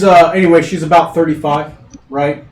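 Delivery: ordinary talk, nothing marked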